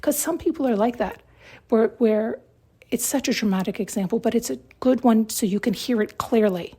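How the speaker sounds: ordinary talk, nothing marked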